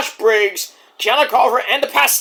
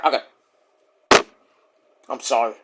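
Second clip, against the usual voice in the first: ordinary talk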